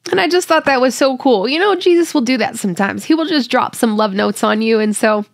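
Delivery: fighting back tears while sharing; real, tearful tenderness; emotional. Real, not acted. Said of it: "I didn't want to get emotional about this".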